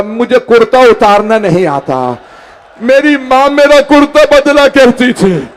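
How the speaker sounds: put-on impassioned mourning recital of a child's words; retold tearful plea. Preacher voices the crying younger brother to mourners, tearful plea. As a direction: imitate a weeping child's plea, swelling into a wail. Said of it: "रो के कहा".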